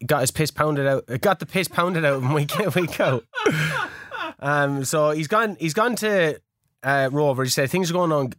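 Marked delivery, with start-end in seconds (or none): 3.3-4.3 short high laugh. Real, not acted. Host laughs at the beaten manager, short high laugh. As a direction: let a quick high laugh out.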